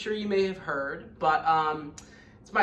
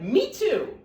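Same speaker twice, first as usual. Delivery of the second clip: put-on young kangaroo; 10 semitones higher, 6 dB louder than usual.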